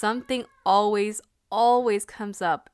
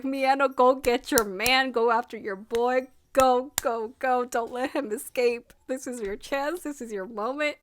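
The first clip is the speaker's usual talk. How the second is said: louder, rallying shout-along; cheering him on; 3 semitones higher, 3 dB louder than usual. Retold or imitated. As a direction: urge Miyano like a fan at the screen. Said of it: "I was like".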